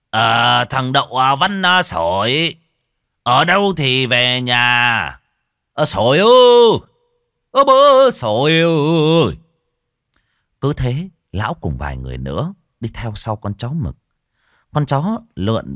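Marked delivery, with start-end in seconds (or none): 0.1-9.4 high, drawn-out calls across the fields; calling out loud. A narrator's reading. Old man calling the missing Sồi home, slow and loud. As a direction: call the lost boy, long and slow. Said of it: "Vừa đi vừa gọi to".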